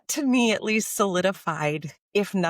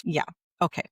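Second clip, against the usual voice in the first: ordinary talk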